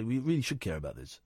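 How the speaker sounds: quick, hushed, preachy mimicry; mock them softly and quickly; mocking preachy quote. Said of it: "I always hate when people say".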